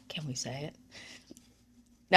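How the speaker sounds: very soft, slow near-whisper; imitated secretive child; hushed sneaky asking. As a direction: ask it under your breath like a kid seeking permission. Kids asking permission to cuss.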